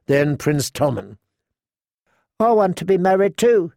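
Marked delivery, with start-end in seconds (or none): 2.4-3.7 put-on higher, eager small-boy voice; childish, higher-pitched royal boy; little princeling. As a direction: play a nine-year-old prince piping up. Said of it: "said the plump little princeling".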